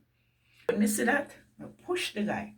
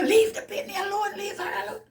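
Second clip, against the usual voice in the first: put-on much higher and louder, a man ordering the boys off; thundery command; 6 dB louder than usual. Farmer telling the boys to leave the child alone, booming. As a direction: shout them down like thunder. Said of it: "the man voice is so thundery".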